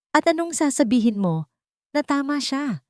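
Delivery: mother mocking her son's plan; sarcastic retort. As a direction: sneer the question at her son. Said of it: "Mataray na sagot".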